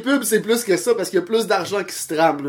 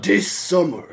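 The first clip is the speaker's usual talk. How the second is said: movie trailer voice; slower than usual. Pretend. Mock movie trailer in English.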